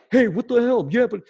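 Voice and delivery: high, hammed-up hostile snap; mock aggressive reply. What he chose not to say, mock hostile. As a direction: play the aggro version you avoided. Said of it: "not be like".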